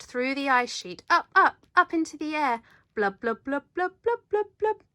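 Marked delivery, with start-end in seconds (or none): 2.9-4.8 bubbling whale sounds. Acted. Whale flies up into air.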